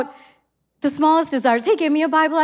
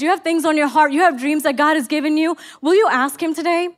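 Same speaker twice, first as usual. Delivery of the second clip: ordinary delivery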